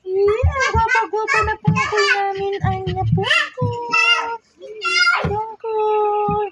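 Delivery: high, drawn-out doting call; fond high cooing. Real, not acted. Fondly praising and calling Pungkol. (mataas at malambing na pagtawag)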